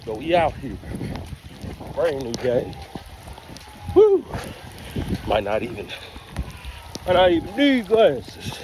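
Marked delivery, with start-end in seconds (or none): 3.9-4.2 high excited whoop. Real, not acted. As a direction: whoop with joy. Cheering out in rain.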